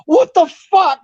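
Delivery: high, loud mock shock; retold startled yell. Tentmate finding a man in a hula skirt, mock startled. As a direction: imitate a man jumping in alarm.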